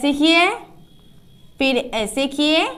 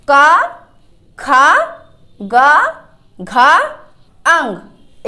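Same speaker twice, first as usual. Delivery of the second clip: loud classroom recital, a pause after each letter; loud syllable recitation; 5 dB louder, much slower than usual. Shown as a demonstration. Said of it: "फिर से पढ़ते हैं सारे अक्षरों को".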